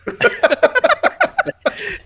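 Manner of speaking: high loud cackle; big real laugh; loud burst of laughter. Real, not acted. Laughing at the joke.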